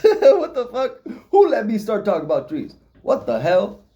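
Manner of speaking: high laughing what-the-fuck, slowed; laughing disbelief. Real, not acted. Vlogger laughing at himself for ranting about trees. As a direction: crack up at yourself mid-question. Amused at his own rant.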